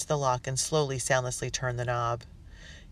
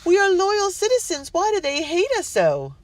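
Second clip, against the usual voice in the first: frightened cry; 7 dB louder than usual. A narrator's reading. Wife distraught at mob.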